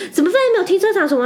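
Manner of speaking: fast, high, loud mock complaint; angry complaining guest. Guest keeps scolding staff about parking, fast high mock complaint. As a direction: rattle off the grievance at speed, outraged. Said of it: "他一直说".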